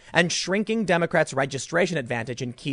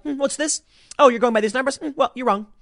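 high, sneering mock journalist; snooty journalist; 8 semitones higher than usual. Mocking the interviewer who grilled Trump, high and snooty. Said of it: "that's how he was acting".

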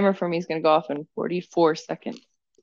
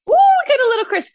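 high, fast and loud delight; delighted squeal; 9 dB louder, faster than usual. Real, not acted. Delight at crispy leaves.

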